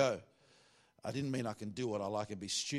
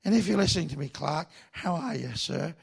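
Clark Taylor; 4 semitones higher, 6 dB louder than usual. Retold or imitated. Trainee playfully greeting his old pastor in that pastor's high voice. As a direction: impersonate the old cowboy pastor, pitched up. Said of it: "That's how he talks".

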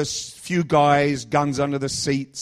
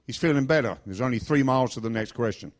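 usual speaking voice

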